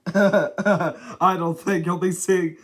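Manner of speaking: chuckling. Acted. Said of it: "chuckled Mister Noisy".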